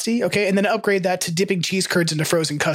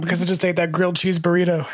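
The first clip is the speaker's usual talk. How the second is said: ordinary talk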